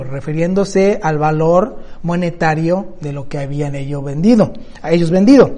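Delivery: ordinary delivery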